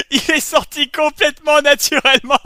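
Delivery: talking through a laugh. Real, not acted. Host reliving his blooper, laughing. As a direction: laugh while you speak. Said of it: "celui-là".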